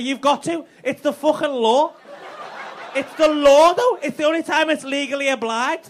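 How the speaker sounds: high, loud laughing insistence; husband insisting to his wife, loud and laughing; retold shouting. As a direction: yell it like it's the law. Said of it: "I was like".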